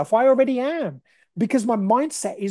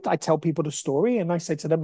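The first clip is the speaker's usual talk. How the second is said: ordinary delivery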